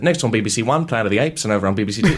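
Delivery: British announcer presenting the next show; BBC announcer accent. Retold or imitated. Mimicking BBC announcers, British broadcast voice. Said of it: "BBC announcers had perfect British accents".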